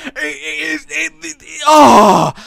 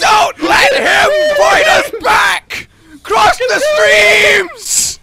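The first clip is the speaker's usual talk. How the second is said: high, drawn-out shouted orders; hammed-up battle command; frantic battle yell; 6 semitones higher, slower than usual. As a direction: stretch each cry like a mock hero. Mock battle orders, excited.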